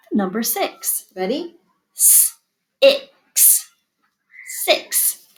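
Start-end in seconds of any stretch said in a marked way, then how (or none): 2.0-3.7 S-ix with a hissed s held apart; sounded out. Shown as a demonstration. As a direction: stretch the s then add ix. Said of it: "first sound green".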